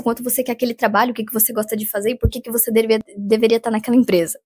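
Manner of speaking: fast, tumbling interview answer; hurried run-on demo, tripping over words; rushed speech. Shown as a demonstration. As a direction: race through it without breathing. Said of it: "Não vai ficar falando com pressa".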